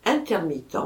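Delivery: usual speaking voice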